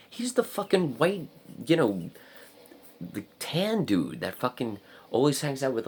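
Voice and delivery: high-pitched amazed explaining; retold excited realization. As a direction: blurt it out high, piecing it together.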